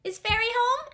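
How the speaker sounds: high eager puppet question. Acted. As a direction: call up anxiously. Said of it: "asked the puppet".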